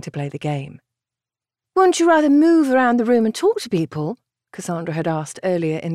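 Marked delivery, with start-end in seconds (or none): 1.7-4.2 light, coaxing sister voice; young woman voice. A narrator's reading. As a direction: voice the gentle sister. Cassandra voiced in audiobook.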